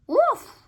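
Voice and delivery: high, loud single woof; high little bark. Acted. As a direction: bark like a small dog. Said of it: "barked the dog".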